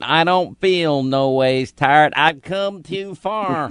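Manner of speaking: slow, drawn-out mock preacher voice; mock preacher drawl. Host mocking Hillary's church speech, slow hammed-up preacher drawl. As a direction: stretch it out like a put-on Southern preacher.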